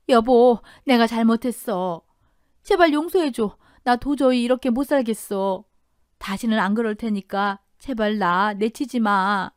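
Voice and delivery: drawn-out, acted begging; pleading. Cheating wife begging her husband for forgiveness, pleading. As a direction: beg desperately to be taken back.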